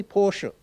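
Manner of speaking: Porsche with two syllables, PORSH-uh; German Porsche. Shown as a demonstration. Preacher leads the congregation in saying Porsche, PORSH-uh. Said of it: "So say with me".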